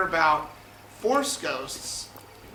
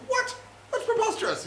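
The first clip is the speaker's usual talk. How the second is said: high-pitched flustered disbelief; C-3PO. Acted. C-3PO told who made him, high indignant disbelief. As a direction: protest high, like an affronted robot. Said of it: "And he's like".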